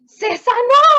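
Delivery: stunned exclamation at a healing; astonished. Retold or imitated. Preacher recalls watching the woman bend down healed, astonished. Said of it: "la quedo mirando así con cara de".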